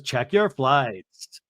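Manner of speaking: "Check your flights" stretched out very slowly; "Check your flights" as a slow, deliberate statement; imitated slow instruction. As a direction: draw out each word of "Check your flights". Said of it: "then Lisa goes".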